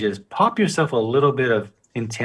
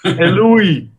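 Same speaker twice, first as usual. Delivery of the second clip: loud, high New York greeting; a hammed-up New York Hey, Louis; New York accent; 7 semitones higher, 7 dB louder than usual.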